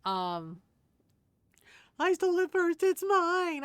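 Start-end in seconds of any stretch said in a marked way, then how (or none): 2.0-3.6 much higher, louder whiny villain voice; mock greedy crook. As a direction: squeal it like a petty thief, hammed up. Mocking Credence's greedy claim.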